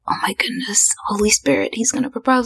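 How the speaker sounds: hushed, high gasp of realisation; acting out a breathless inner freak-out; stunned realisation. Retelling guessing her boyfriend will propose, stunned and hushed.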